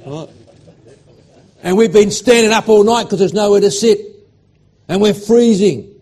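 loud, high, reliving the moment; building excitement, voice rising; excited. Real, not acted. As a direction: swept back into the memory. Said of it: "I'm gonna get excited about it".

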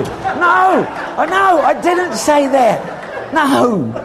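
high, slow laughing no, I didn't say that; mock-outraged denial with a laugh.

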